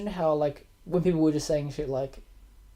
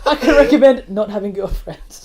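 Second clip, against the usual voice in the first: laughing; 4 semitones higher, 11 dB louder than usual. Real, not acted. Joking aside, laughing through it.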